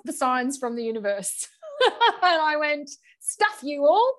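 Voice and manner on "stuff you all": high-pitched defiant retort, retold; mock defiance. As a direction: playfully tell off the whole universe. Retelling her defiant decision.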